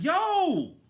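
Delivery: high, loud indignant "Yo"; sharp call. Retold or imitated. Driver calling to the server who skipped him, loud indignant. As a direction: bark it to stop her.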